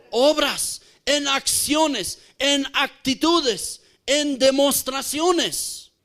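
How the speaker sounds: slow, deliberate list of deeds; slow emphatic list. Real, not acted. Preacher listing deeds to the church, slow and deliberate. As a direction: lay out each word like a step.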